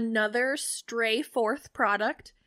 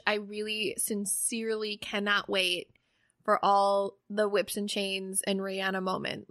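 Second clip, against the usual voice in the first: normal talking voice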